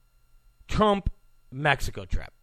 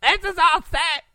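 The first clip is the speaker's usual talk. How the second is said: much higher, squeaky mock talk-radio host; pitched-up parody of a serious pundit; Mark Levin impression; 12 semitones higher than usual. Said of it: "Mark Levin".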